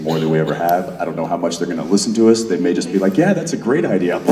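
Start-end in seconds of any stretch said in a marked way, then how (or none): 3.2-4.2 higher, placating mock praise; mock approval. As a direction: humour them with put-on enthusiasm. Imagined writers humouring the actors.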